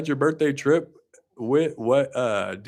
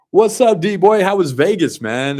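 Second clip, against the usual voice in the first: loud greeting; 6 semitones higher, 9 dB louder than usual. Real, not acted. Greeting co-host loudly on call.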